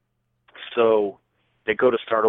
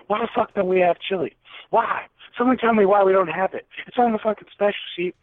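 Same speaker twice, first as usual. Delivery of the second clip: retold angry yelling; 10 semitones higher than usual. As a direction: blow up at the staff over the chili. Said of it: "I snap, Jed. I start snapping".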